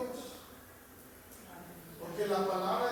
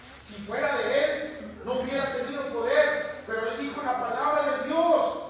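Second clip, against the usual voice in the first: loud, driving pulpit preaching; preaching at full force; 5 semitones higher, 9 dB louder than usual. Acted. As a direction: thunder the miracle out to the congregation.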